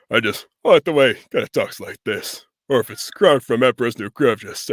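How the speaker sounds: loud, deadpan actor impression; Patrick Warburton. Voice actor showing a go-to impression, loud deadpan delivery. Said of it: "really love Patrick Warburton, as, as Brock Samson".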